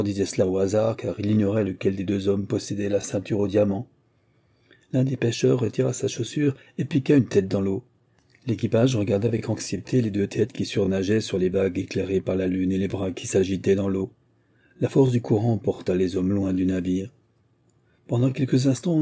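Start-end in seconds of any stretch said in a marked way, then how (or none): none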